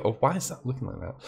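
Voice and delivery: ordinary talk, nothing marked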